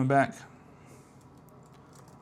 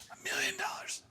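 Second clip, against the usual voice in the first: whispered aside; 9 dB quieter than usual. Real, not acted. Coder betting the fix fails whispers it again. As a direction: repeat "million dollars" in a whisper.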